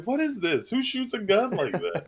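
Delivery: high-pitched incredulous questions; hammed-up, high bewilderment; mock disbelief. Acted reaction to film scene.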